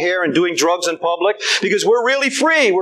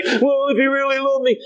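mock self-pity; 4 semitones higher than usual. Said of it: "Don't sit around and say".

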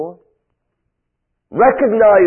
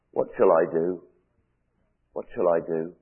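softer, lower, lost and helpless; despairing murmur; 5 semitones lower, 6 dB quieter than usual. Retold or imitated. Preacher voicing a lonely listener's despair, soft and low. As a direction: let it sink, quiet despair. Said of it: "And you're saying tonight".

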